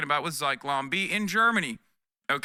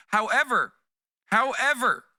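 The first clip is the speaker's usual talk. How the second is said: emphatic however; 7 semitones higher, 5 dB louder than usual. Real, not acted. Ranting streamer raising a point.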